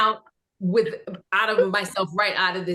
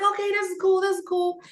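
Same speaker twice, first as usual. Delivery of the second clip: much higher, barely contained thrill; giddy excitement; 12 semitones higher, faster than usual. Retold or imitated. Recalling the first table read to the panel, in a high giddy voice. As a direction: squeal it under your breath, trying to stay cool. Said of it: "I'm like".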